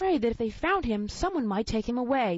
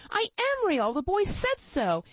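higher, slow, on the verge of crying; mild tearful plea, higher and slow; near tears; 7 semitones higher, slower than usual. A narrator's reading. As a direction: hold back tears as you insist. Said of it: "he nearly began to cry".

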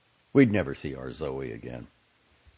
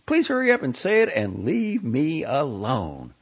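please hit hard, then the rest trails off; stress on please; 9 semitones higher, 4 dB louder than usual. A narrator's reading. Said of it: "This last emphasized word".

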